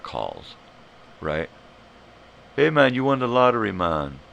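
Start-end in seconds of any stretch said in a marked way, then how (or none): 2.6-4.2 put-on loud, high, quick Jamaican accent; Jamaican scammer. Scammer announcing a lottery win, mock Jamaican accent. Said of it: "this guy in Jamaica".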